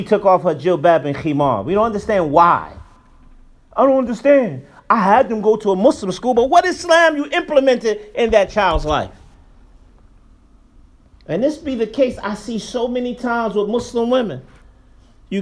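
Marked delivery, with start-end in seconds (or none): none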